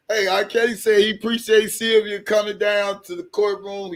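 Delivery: ordinary talk, nothing marked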